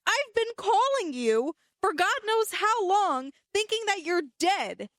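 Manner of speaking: ranting half-joking at the sister; mock angry rant. Sister scolding her friend for answering, mock furious.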